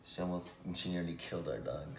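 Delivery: ordinary talk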